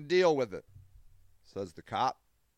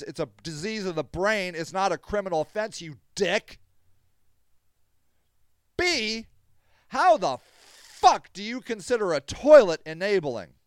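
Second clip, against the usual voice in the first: louder, higher heated rant; real anger; 3 semitones higher, 5 dB louder than usual. Real, not acted. Advocate rants at critics calling him an enabler, angry. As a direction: tear into the critics. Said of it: "See, you're getting me mad".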